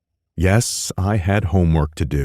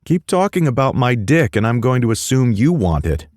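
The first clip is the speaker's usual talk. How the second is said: taunting; 8 semitones higher, 3 dB louder, faster than usual. Acted. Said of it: "I taunted".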